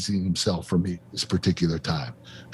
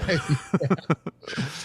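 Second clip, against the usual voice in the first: a short real laugh at the host's teasing; 4 semitones higher than usual. Real, not acted. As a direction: laugh it off, caught out by the joke. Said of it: "Some of us are more stubborn than others".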